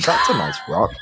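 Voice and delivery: high, giddy voice admiring a rock; rock-obsessed inner voice. A narrator's reading. GM voicing the player's inner thoughts, giddy and high. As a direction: coo over the pretty rock in a high voice. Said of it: "you start to say to yourself".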